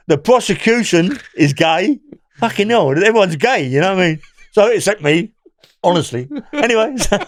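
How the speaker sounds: speaking through laughter; laughing. Real, not acted. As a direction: laugh while you tell it. Laughing about courtroom.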